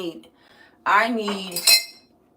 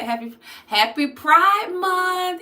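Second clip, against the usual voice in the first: cheerful greeting; 10 semitones higher, 6 dB louder, slower than usual. Real, not acted. Greeting viewer.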